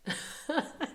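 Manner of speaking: short, soft high chuckle; high, quiet burst of laughter; amused laugh. Real, not acted. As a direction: giggle softly at yourself. Laughing about own accents.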